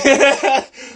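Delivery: a high, bright burst of laughter at himself. Real, not acted. Stepping off his soapbox, he laughs at himself. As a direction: laugh it off after the rant.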